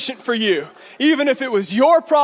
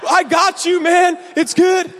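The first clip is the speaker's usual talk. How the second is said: higher, lightly mimicked buddy voice; mock reassurance; 6 semitones higher, slower than usual. Friend to the driver being chased, mock eager reassurance. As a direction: imitate a friend promising support.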